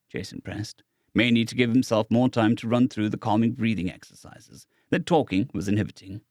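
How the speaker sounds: plain reading voice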